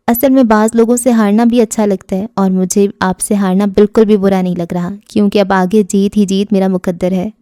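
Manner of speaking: ordinary delivery, nothing marked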